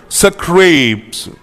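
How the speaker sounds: स्क्रैप्स said slowly as सक-रेप्स; careful pronunciation. Shown as a demonstration. Teacher drilling students on saying स्क्रैप्स. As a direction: sound out स्क्रैप्स with a light sak before reps. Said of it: "इसकी pronunciation आपने time and again करनी है".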